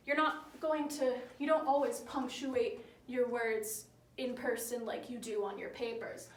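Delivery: normal talking voice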